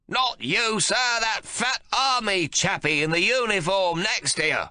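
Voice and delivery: put-on high, loud, slow cranky old man complaining; cantankerous old father fuming; irascible old man. A narrator's reading. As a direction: grumble like a furious old codger. Old man figure held.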